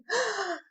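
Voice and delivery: high, startled intake of breath; sharp gasp. Retold or imitated. Hearing the wake-up call, startled gasp. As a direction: gasp as it hits you.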